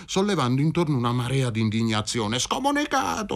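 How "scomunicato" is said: sharp high cry of outrage; shouting. A narrator's reading. Church women shouting at Michele, high outraged cry. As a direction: hurl the word at him. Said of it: "gli gridarono".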